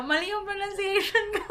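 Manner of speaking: high, laughing admission. Real, not acted. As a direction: laugh through it, pitch way up. Laughing at her own mistake. (natatawang pag-amin)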